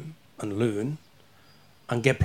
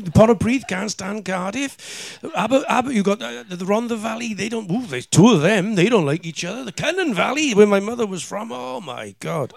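loud, high, rising rant; worked-up rant; 11 semitones higher, 10 dB louder than usual. Retold or imitated. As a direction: build up into an outraged rant. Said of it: "I used to think".

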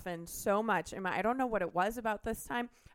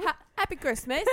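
a louder, higher cockney happy Christmas; cockney accent; 6 semitones higher, 5 dB louder than usual. Pretend. Answering her co-host, [name] says happy Christmas in cockney. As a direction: throw on a cheeky cockney accent, Oliver Twist style. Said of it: "yours is a little cockney".

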